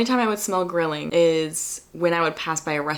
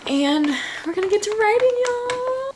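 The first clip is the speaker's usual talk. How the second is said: much higher, louder sing-song hype; excited announcement; 3 dB louder than usual. Real, not acted. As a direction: announce it like a cheer to your friends. Cheerful announcement to viewers.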